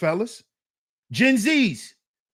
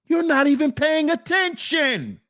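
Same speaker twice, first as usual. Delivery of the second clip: shout; 6 semitones higher than usual. Real, not acted. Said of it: "shout heard".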